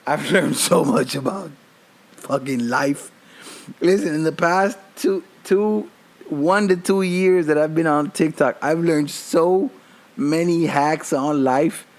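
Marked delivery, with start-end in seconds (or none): none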